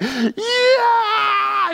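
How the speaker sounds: high, loud mock cry of joy; sudden high whoop of joy; pretend joyful shout. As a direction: belt out a pretend yell of joy. Imagined shout alone at home.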